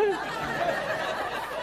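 airy chuckle, high and unvoiced; high breathy laugh. Preacher laughing at the soldiers backing off, airy high laugh.

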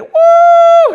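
loud, high-pitched celebratory whoo; big triumphant holler; victory whoop. Acted. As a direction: whoop as if the battle is won. Acting out victory as walls fall.

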